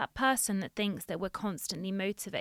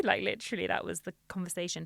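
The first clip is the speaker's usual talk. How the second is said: ordinary talk, nothing marked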